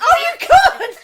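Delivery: loud, high shriek of amusement; delighted high-pitched outburst; laughing exclamation. Real, not acted. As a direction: crow it through real laughter. Friend cracks up at the reveal.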